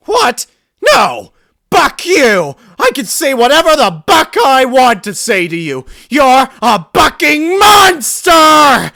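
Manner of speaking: very high, loud screaming rant; a grieving daughter screaming at her father's killer; furious screaming tirade. Acted. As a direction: unleash every word in rage.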